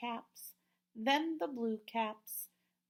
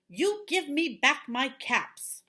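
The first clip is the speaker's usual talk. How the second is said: louder scolding demand; angry peddler; 6 semitones higher, 7 dB louder than usual. Acted. Peddler scolding the monkeys up the tree, louder angry demand. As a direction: scold the monkeys, loud and cross. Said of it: "This made the peddler angry".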